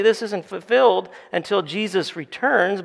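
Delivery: ordinary delivery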